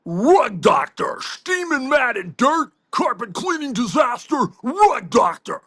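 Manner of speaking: slow booming mock commercial voiceover, Rug Doctor drawn out; ad announcer. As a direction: sell it like a cheesy TV spot, dragging Rug Doctor. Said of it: "another sponsor today".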